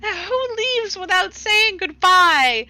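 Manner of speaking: high, loud, wailing protest; overwrought scolding. Acted. Althea scolding Cassandra for leaving without goodbye, overwrought. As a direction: wail it in playful indignation, drawing out the last words.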